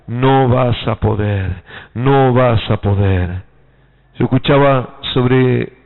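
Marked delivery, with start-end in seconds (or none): 0.1-3.4 slow, low discouraging verdict; Saul. Retold or imitated. Doubting king tells David he will not manage, slow and low. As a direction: pronounce it slowly, low and final. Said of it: "¿Qué dijo la-, Saúl?".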